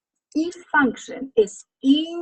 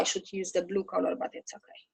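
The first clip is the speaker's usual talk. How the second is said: voiceless whisper, much softer; whispered aside; 3 semitones lower, 11 dB quieter than usual. Real, not acted. Teacher noticing the wrong marker, whispering to herself. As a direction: brush it off under your breath.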